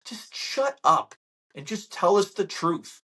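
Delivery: high, slow "Just shut up"; fed-up demand. Real, not acted. Telling the media off, slow and high. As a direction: drag out each word in frustration.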